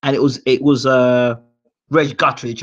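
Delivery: ordinary talk, nothing marked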